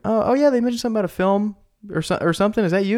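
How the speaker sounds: high, eager imitation of his younger self; naive young self. Teenager realising who's calling, high mock eagerness. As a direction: sound clueless but keen, pitched well up. Own past self voiced.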